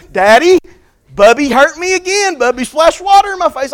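a hint of a child tattling on her brother; whiny little girl. Little Ellie tattling to daddy about her brother, lightly whiny child voice.

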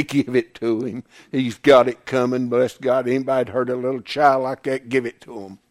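low, soft acted onlooker; low approving aside. Onlooker approving punishment of someone who hurt a child, low and quiet. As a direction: drop low and quietly egg it on.

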